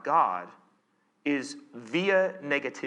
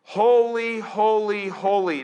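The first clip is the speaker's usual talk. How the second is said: raised, drawn-out chant-like proclamation; calling out; 5 semitones higher, 5 dB louder, slower than usual. Acted. Seraphim call to one another, calling out in praise. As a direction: proclaim each word high and strong, easing off. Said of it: "They call to one another".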